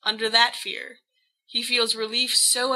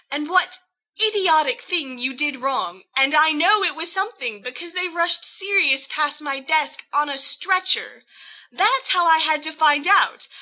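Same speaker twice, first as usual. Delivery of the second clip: put-on higher, tight rising fury at a reckless boyfriend; furious Lily; 6 semitones higher than usual. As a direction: pour out the anger she held in, word after word.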